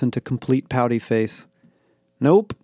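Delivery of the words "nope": high, punchy refusal; decisive. A narrator's reading. Daniel refusing Becky a Diet Pepsi, decisive. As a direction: shut it down in one firm word. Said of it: "I said decisively".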